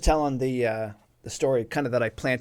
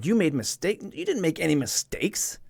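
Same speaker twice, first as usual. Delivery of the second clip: higher, louder retold disbelief; incredulous, pitched up; indignant disbelief; 6 semitones higher, 3 dB louder than usual. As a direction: protest as if you can't believe it. Retelling his indignant inner reaction.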